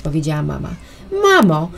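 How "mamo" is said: loud, scared cry for mom; frightened cry. A narrator's reading. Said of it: "krzyknęła przerażona Tośka".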